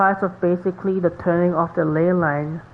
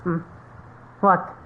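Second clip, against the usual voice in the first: normal talking voice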